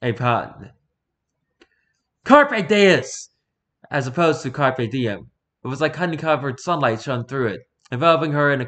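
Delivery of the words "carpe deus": loud, high, triumphant yell. A narrator's reading. Mage yelling his spell pun at the goddess, loud. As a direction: shout the spell out. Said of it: "yelled at what I think".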